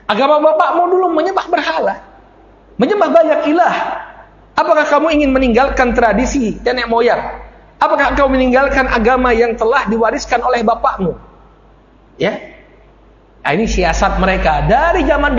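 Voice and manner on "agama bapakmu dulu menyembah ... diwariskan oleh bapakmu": high-pitched indignant questioning; acted challenge. Abu Jahal pressing Abu Talib over his father's religion, acted challenge. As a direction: press him with raised, insistent pitch.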